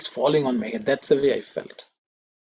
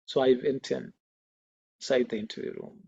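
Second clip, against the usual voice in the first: ordinary talk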